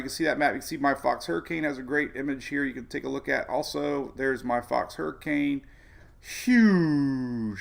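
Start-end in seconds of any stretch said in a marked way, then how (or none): 6.2-7.6 long, loud, falling HUGE; hammed-up, sliding down through a long vowel; drawn-out exclamation. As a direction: stretch "Huge" right out, playfully big. Hammed-up word for viewers to repeat.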